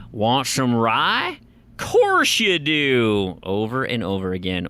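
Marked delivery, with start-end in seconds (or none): 0.1-3.4 slow, drawling offer with swooping pitch; game character voice. Retold or imitated. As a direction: stretch each word in a hammy character voice. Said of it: "I don't even know if that's the voice".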